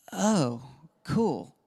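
playing it cool; super chill. Dad hiding delight at daughter's compliment, super chill. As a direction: act casually unimpressed on the surface. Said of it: "you act super chill".

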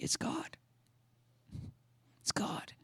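very soft, unvoiced reverence; hushed, reverent repetition; awed whisper. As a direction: whisper it as if deeply moved. Moved by seeing the tears.

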